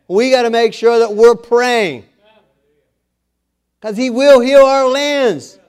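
rally the church, voice climbing to a shout; full-force preaching. Acted. Preacher builds to a rousing climax.

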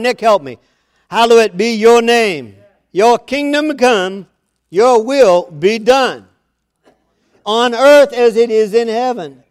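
loud, lifted prayer read; prayer recitation. Acted. Preacher leading the church in the Lord's Prayer, loud and lifted. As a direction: proclaim the prayer to the congregation.

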